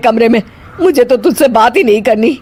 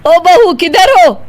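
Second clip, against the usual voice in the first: raised, higher urgent summons; calling out; 6 semitones higher than usual. Acted. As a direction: call out for her through the house. Mother-in-law calls for her, looking around.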